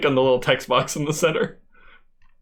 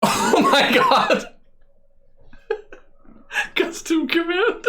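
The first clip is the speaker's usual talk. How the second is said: high squealing laugh through words; cracking up mid-sentence; laughing hard; slower than usual. Real, not acted. Cracking up at clip.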